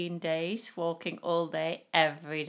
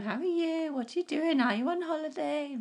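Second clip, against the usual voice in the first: lightly mimicked cheery shopkeeper, pitched up; bright friendly till chatter, higher; imitating a chatty cashier asking questions; chatty shop assistant; 9 semitones higher than usual. Mimicking friendly Dorset shop staff.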